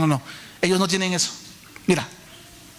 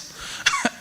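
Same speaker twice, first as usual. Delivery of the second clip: risa aguda; 4 dB quieter than usual. Real, not acted. Risa aguda tras amén.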